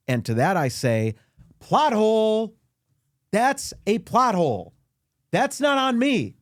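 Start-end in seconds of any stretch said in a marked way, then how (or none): 1.6-6.3 high, loud indignant protest; indignant rant. Acted.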